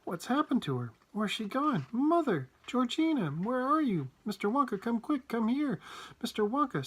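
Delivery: high, frantic calling; panicked crying out. A narrator's reading. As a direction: call for help in rising panic. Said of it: "Missus Bucket cried out".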